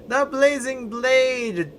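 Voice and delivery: "The Blazing Blade!" called out high, slow and loud like a trailer; hammed-up title call. Shown as a demonstration. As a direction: announce "The Blazing Blade!" as a grand, drawn-out epic title.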